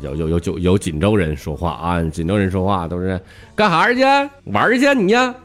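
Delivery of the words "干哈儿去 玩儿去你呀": Jinzhou dialect with every phrase hooked sharply up at the end; sing-song Jinzhou rise, pitched much higher; rising Jinzhou accent tune. Retold or imitated. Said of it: "往上挑的".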